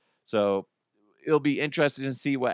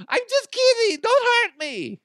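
very high, loud squealing Russian plea; hammed-up Russian squeal; high-pitched Russian billionaire; 6 dB louder than usual. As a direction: beg in a squeaky mock Russian accent. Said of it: "that high-pitched voice".

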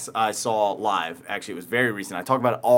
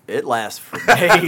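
ordinary talk

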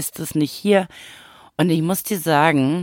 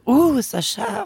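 mock high-pitched gasp at the spice; high squeaky surprise; 12 semitones higher than usual. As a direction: squeak it like tasting something hot. Imitating people tasting kimchi.

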